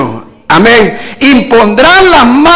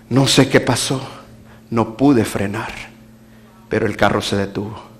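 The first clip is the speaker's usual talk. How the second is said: shaken driver; 12 semitones lower, 10 dB quieter than usual. Retold or imitated. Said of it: "el hombre estaba temblando".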